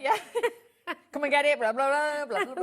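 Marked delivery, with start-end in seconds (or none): 1.1-2.6 loud, rapid mock auctioneer patter; hammed-up auctioneer calling bids. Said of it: "like an auctioneer".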